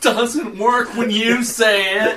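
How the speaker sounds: high, slow, silly voice; mock put-on voice. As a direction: drawl it out high, teasing a teammate. Keeps up the put-on voice.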